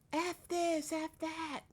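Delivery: imitating her swearing; mock loud cursing. Neighbor imitates the homeless woman cursing loudly, mock angry rant. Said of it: "talking really loud".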